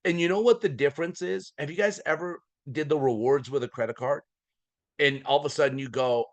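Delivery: ordinary delivery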